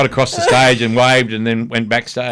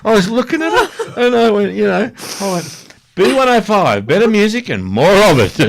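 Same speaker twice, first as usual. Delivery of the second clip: much higher, louder, speaking through laughter; laughing helplessly; 11 semitones higher, 6 dB louder than usual. Real, not acted. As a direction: barely get the words out through tears of laughter. Still laughing through the story.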